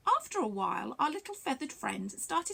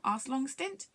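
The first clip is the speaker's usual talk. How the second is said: usual speaking voice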